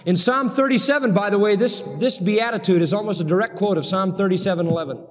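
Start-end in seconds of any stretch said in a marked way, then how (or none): none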